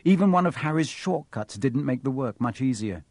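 usual speaking voice

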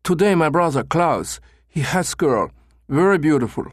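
an eager German tourist; broken German English. A narrator's reading. Becker as German asking for an escort, broken English. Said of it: "Becker continued in broken English".

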